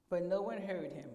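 normal talking voice

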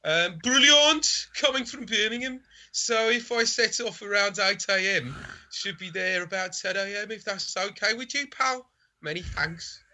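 put-on Brummie, mock-refined; sophisticated Brummie. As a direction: play the polite buyer in broad Brummie. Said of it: "I'm going sophisticated Brummie".

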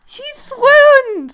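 high, loud delighted squeal; excited squeal. Real, not acted. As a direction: gush about the romance. Delighted at a romance memory.

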